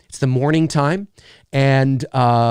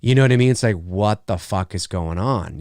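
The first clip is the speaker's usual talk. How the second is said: usual speaking voice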